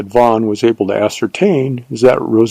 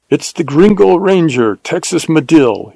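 higher, excited shout of recognition; 5 semitones higher than usual. Acted. Bandit leader announces the captured ranger, shouting. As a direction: call it out to the whole gang. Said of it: "he shouted in Spanish".

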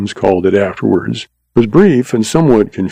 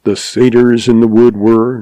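plain reading voice

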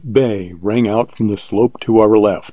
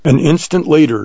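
ordinary delivery

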